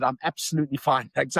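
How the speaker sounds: ordinary delivery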